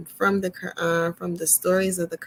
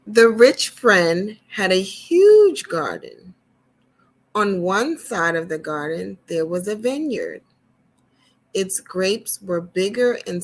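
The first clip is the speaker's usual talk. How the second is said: gentle storyteller reading to little ones; storybook reading; 5 dB louder than usual. Acted. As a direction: read it like a picture book to kids. Reads storybook to children.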